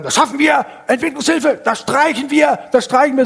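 high, loud ranting shout; angry yelled slogans. Retold or imitated. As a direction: bellow it like a boss losing his temper. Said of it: "So schrie der immer nur rum".

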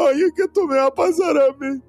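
mock wail. Preacher mocking fearful believers, crying lament. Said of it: "están hablando acerca de su final".

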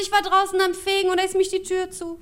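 put-on high, wailing old-lady report; grandma voice. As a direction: report the mishap as a hapless granny. Grandma phoning, locked out again.

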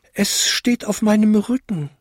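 high little-bell voice; tinkling little bell. A narrator's reading. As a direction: ring it out like a tiny bell. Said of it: "bimmelte die kleinste Glocke". (hohe, bimmelnde kleine Glocke)

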